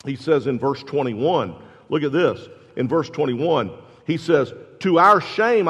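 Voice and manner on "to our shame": sarcastic. Retold or imitated. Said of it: "That's another sarcastic".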